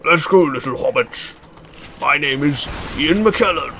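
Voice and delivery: lightly voiced grand old wizard; half-successful actorly British impression; Ian McKellen. Gamer leading his little hobbits, rough actor impression. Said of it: "I can't do a very good Ian McKellen voice".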